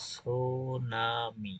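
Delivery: Tsunami stretched very slowly with a short i; slow model word. Shown as a demonstration. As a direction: drag out tsunami syllable by syllable. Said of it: "tsunami বানানটা দীর্ঘিকার হয় না".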